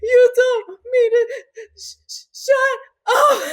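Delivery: high crying yell, stumbling on shut; tearful shout. Retold or imitated. Hurt daughter tells dad what he said, crying out. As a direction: sob it out at him. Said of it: "And I was like".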